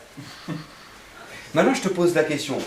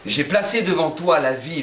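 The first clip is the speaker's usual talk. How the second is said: normal talking voice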